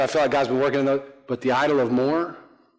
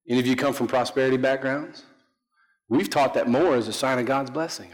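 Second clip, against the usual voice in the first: usual speaking voice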